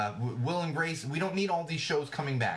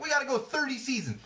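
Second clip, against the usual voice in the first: high-pitched mock eagerness; mock insistence; 12 semitones higher than usual. Host mimicking a show that won't end, mock insistence. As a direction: push it up high, parodying stubborn insistence. Mocking The Simpsons' endless run.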